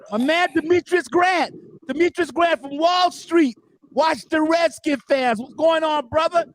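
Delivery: high-pitched, drawn-out shout-out; hyped welcome. Real, not acted. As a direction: greet a favorite listener, high and slow. Host hails a newly arrived listener.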